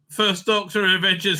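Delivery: ordinary talk, nothing marked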